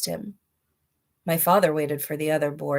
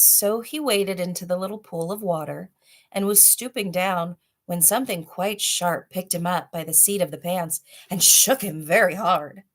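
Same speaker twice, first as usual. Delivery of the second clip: plain reading voice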